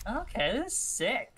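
high, slow, impressed; excited. Real, not acted. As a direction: stretch it out in delight. Sees new sticker feature.

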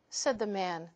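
ordinary delivery, nothing marked

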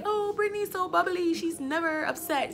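high, fussing mock sympathy; high-pitched worried gossip, imitated; concerned onlookers. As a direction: mimic gushing classmates in a high voice. Onlookers worrying about teller, acted.

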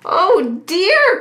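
high, dismayed duck exclamation; storybook duck, pitched well up; quacking duck. A narrator's reading. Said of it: "quacked Sally".

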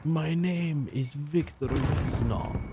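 slow, bad Russian accent; hammed-up Russian accent, drawn out; Viktor Reznov. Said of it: "the worst Russian accent you've ever heard".

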